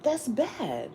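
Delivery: lightly voiced disappointed fruit-picker, high and slow; a hint of mock letdown; mock dismay. Imagining opening a bag of fruit, mild mock dismay. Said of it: "you're like".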